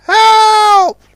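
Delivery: loud high shout; cry for help. A narrator's reading. Customs launch shouting for help, loud.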